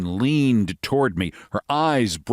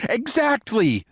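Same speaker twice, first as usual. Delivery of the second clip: American lady voice; 13 semitones higher, slower than usual. Acted.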